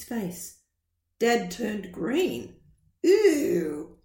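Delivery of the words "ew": a disgusted gasp, a bit louder; dad gasping at the smell. Acted. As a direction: gasp at the stink. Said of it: "he gasped".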